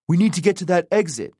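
fast, high-pitched urging; urgent. A narrator's reading. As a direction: rush it out, pressing to leave. Urgent plea to escape underground.

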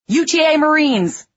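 loud, high commanding bellow; soldier's barked identification shout. A narrator's reading. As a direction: announce yourselves with a bellow. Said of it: "I bellowed".

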